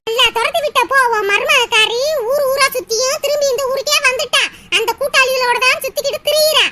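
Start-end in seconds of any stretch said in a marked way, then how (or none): none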